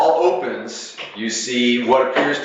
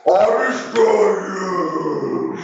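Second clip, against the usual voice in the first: slow, drawn-out bubbling monster threat; 7 semitones higher, much slower than usual. Acted. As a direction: gurgle it like a water creature. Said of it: "in kind of a bubbling voice".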